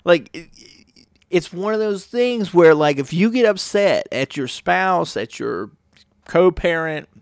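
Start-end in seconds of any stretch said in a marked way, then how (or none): none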